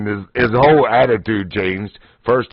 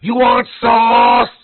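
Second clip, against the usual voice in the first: much higher, louder, slow drawn-out call in a mock Chinese accent; mock yell across the lot; 12 semitones higher, 5 dB louder, much slower than usual. Cashier yelling after departing customers, in a slow high mock Chinese accent. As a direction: holler it slowly across the parking lot. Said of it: "But he yells out".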